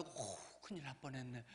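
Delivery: soft, low aside of relief; acted relief. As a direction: let out a hushed sigh of a close call. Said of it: "아유, 안도감도". (안도하며 나직이 혼잣말하듯)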